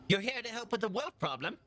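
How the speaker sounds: high, eager dwarf innkeeper voice. Acted. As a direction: perk up hopefully as the innkeeper. Innkeeper perks up, eyes gleam.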